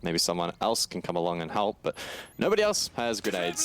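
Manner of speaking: ordinary talk, nothing marked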